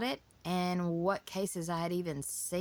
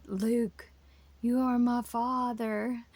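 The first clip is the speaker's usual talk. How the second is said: imitating a child's slow, louder, higher movie-villain line into a fan; playful fan voice; 6 semitones higher, 5 dB louder, much slower than usual. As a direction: draw it out dramatically, like a kid playing at a fan. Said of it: "sitting in front of a fan going".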